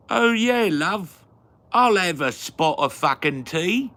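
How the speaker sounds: loud, high-pitched mock British; hammed-up British accent. Accepting a spot of tea, in loud mock British. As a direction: belt it out as a caricature Brit.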